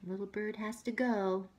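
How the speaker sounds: plain reading voice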